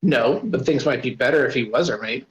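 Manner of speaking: ordinary delivery, nothing marked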